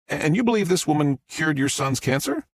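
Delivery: upbeat live news report voice; TV reporter. Acted. Reporter Fox addresses the camera in a live news voice. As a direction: deliver it like a reporter live on air.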